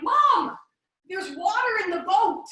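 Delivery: put-on high, loud little kid calling out; imitating five-year-old. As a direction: call to Mom like an alarmed child. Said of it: "My five-year-old's like".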